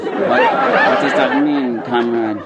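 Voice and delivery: slow, deadpan comrade voice; humorless communist. Acted. Communist baffled by a joke, slow humorless parody. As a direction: drag it out, missing the joke entirely. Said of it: "they never smile, and they don't catch on to jokes".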